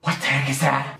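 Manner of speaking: ordinary talk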